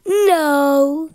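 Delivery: loud put-on silly monster voice; grumpy guitar voice. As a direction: bark it out as a cranky guitar. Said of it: "why are you so cranky?".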